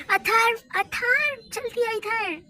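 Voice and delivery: a mother shouting for her son to come quickly; urgent calling out. Acted. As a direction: shout for the son across the house.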